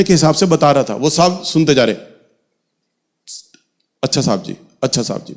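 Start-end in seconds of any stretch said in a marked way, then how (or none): 4.0-5.3 a hint of a respectful constable, softer; mock obedient murmur. Constables meekly answer their officer, softer, a hint of deference. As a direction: answer the boss meekly, yes sir, yes sir.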